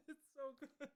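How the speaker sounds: high, soft laughing words. Real, not acted. Cereal fan defends it to disgusted friends, laughing. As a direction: gush through helpless giggles. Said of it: "maybe it's also 'cause I'm laughing".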